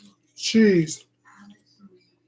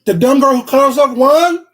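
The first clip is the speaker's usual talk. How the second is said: loud, much higher outraged question; shocked disbelief; 8 semitones higher, 11 dB louder than usual. Real, not acted. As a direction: shout it in disbelief at the TV. Reacting in shock to TV show.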